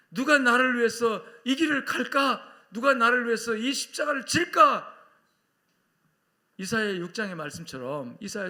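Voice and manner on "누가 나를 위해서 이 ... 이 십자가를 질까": high, loud preacher's cry. Acted. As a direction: call out for someone to volunteer. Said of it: "얼마나 주님 외치십니까". (높고 크게 외치며)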